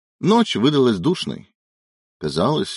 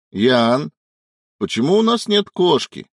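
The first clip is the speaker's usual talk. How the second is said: higher, raised voice calling through the flat; 5 semitones higher, 4 dB louder than usual. A narrator's reading. Husband to wife in another room, calling out. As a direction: call to someone in another room. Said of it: "крикнул Илья, обращаясь ко всем комнатам сразу".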